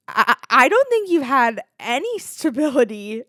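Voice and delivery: hammed-up, stammering start, then emphatic; exaggerated roommate quote. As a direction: overplay the roommate's verdict. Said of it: "She didn't say it like this".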